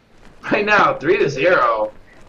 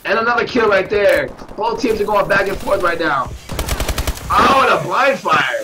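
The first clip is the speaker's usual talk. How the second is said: higher, louder, stretched-out calls of each kill; excited play-by-play; 6 semitones higher, 3 dB louder than usual. Real, not acted. Caster calling back-and-forth kills for viewers, rising excitement. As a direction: ride the momentum kill after kill.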